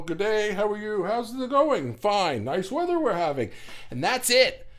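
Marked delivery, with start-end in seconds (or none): 0.0-3.5 high, sing-song mock pleasantries; mock small talk. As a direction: chirp empty pleasantries like a polite neighbour. Acting out bland polite small talk.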